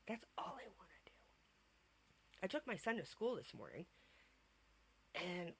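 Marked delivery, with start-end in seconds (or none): 0.1-1.1 breathy whispered complaint; conspiratorial whisper; whispered aside. Real, not acted. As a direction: drop to a whisper for the punchline. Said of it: "complaint about bed rest".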